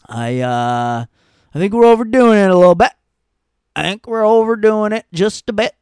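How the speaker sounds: hammed-up mocking character voice; mock prissy voice. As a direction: poke fun in a prim put-on voice. Mocking someone overdoing it.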